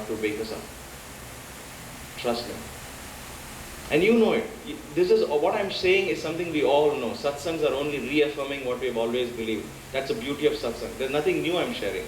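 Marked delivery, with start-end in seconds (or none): none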